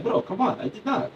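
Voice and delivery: mock indignant guy waving off blame; protesting Israeli guy. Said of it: "A guy from Israel".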